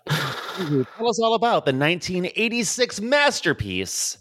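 announcer voice. Acted. Hosts' bit.

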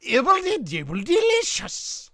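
high, sing-song wizard catchphrase. Pretend. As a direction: squeak it like a goofy wizard. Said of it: "You wanna try?".

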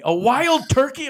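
imitated astonished echo; incredulous. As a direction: draw it out in mock disbelief. Disbelieving relative voiced in story.

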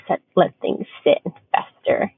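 ordinary talk, nothing marked

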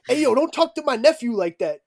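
loud, high retold warning; angry uncle. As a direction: imitate an uncle telling strangers off.

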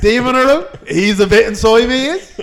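loud, high mock-Australian outburst; hammed-up Australian, lifting into questions; frazzled Australian. Demon-infested man explaining his demon, frazzled Australian accent. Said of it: "the demon infested frazzled Australian".

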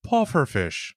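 drawn-out Irish-accented word. Shown as a demonstration. Dad demonstrating his bingo word to co-host, Irish accent. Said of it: "I have to say with an Irish accent".

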